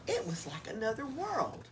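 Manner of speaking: plain reading voice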